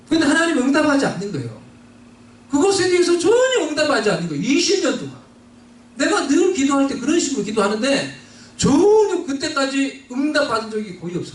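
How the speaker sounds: usual speaking voice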